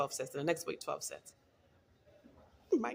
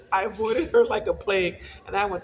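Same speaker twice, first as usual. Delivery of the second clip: loud words through laughter; real laughter spilling into the words; laughing while talking; 2 semitones higher, 10 dB louder than usual. Real, not acted. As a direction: talk it out while still laughing.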